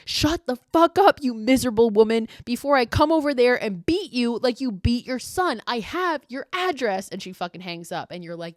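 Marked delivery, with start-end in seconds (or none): 0.1-7.1 furious shout, much higher and louder; losing it. Retold or imitated. Emily finally snaps at the screaming woman, a furious shout. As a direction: explode at the abusive caller. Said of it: "finally just, like, loses it".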